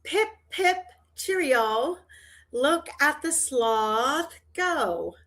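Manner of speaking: high, chirpy mock British chirp. Shown as a demonstration. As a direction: trill it out like a cheery Englishman. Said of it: "Make sure Quester says the following".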